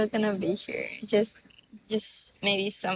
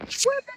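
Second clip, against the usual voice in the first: mock whoop; 3 dB louder than usual. Imagining phone falling sound.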